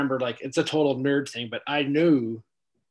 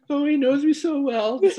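mock sentimental; 9 dB louder than usual. Mock touched by gift.

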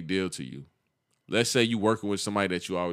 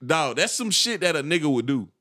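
usual speaking voice

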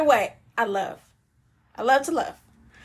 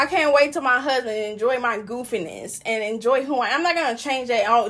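ordinary delivery

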